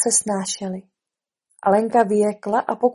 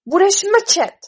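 high, loud royal outburst; angry silencing shout; 10 semitones higher, 7 dB louder, slower than usual. Acted. Queen orders Alice silent, shouting angrily. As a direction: shout her down, pitch flung high. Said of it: "okřikla jí královna".

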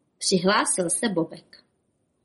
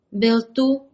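ordinary delivery

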